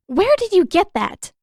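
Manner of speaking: high, loud demand. Acted. Katara demanding at Zuko's retreating back, loud and high. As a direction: call it after him sharply. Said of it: "she demanded".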